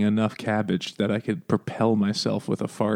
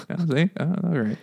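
ordinary talk, nothing marked

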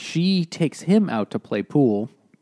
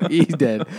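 laughing; 5 semitones higher, 8 dB louder than usual. Real, not acted.

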